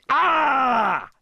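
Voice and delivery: loud, high scream. A narrator's reading. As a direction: let out a panicked scream. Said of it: "Shrek screamed".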